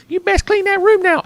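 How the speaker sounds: shrill up into a bossy mother; mom scolding. Retold or imitated. Mom bursting in ordering the kid to clean, mock high scolding. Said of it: "she's like".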